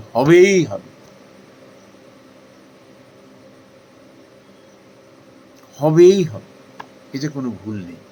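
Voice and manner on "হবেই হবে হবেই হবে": louder, hammering হবেই হবে; emphatic promise. Real, not acted. Preacher assuring devotees, loud and certain. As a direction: promise it with full conviction. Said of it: "emphatic promise".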